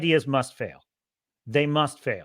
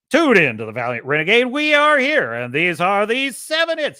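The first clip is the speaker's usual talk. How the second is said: fast, loud classic radio announcer; punchy old-school DJ intro, louder and fast; radio DJ cadence; 3 semitones higher, 6 dB louder than usual. Retold or imitated. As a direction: sell it like a rock radio jock introducing the hits. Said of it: "He's just got that cadence to it".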